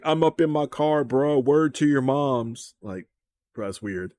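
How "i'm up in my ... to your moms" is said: louder, higher street voice, slow on the ending; mocking a put-on street accent; hammed-up street-talk imitation on camera; street slang imitation. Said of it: "But on camera, you're like".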